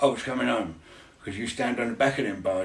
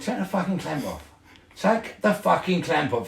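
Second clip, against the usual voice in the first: angry demand; 6 semitones higher, 5 dB louder than usual. Retold or imitated. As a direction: bark it out, losing patience. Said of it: "he went".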